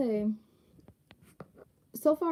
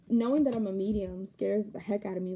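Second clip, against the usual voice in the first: usual speaking voice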